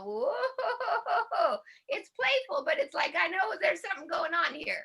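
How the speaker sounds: high, teasing knowing voice; grandma's playful whoa. Retold or imitated. Grandma playfully catching the kids in mischief. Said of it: "my grandma would go".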